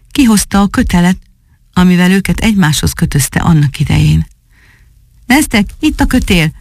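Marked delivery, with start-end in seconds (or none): 5.3-6.5 high call, no extra volume; lightly acted shout up to someone above. A narrator's reading. Baker calls up that he has the rope, calling out. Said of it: "kiáltott fel".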